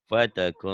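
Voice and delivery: "Fataku" ending in a held nasal hum; ikhfa. Shown as a demonstration. Teacher demonstrates ikhfa, nasalised ending on "Fataku". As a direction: let the end of "Fataku" hum through the nose. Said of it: "ikhfa ya".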